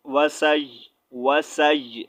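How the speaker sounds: وسائی ending on a light held y sound; light final ya. Shown as a demonstration. Teacher showing how to stop on ya, light-ending demonstration. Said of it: "last میں ہلکی سی".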